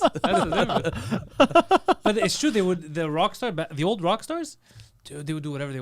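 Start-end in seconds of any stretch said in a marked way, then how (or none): 0.0-2.0 words stretched through laughter; laughing hard. Real, not acted. Agreeing with a friend's joke, laughing hard. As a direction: crack up while saying it.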